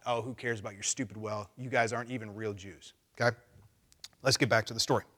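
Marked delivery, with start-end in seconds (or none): 0.1-2.9 mocking. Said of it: "just mock that".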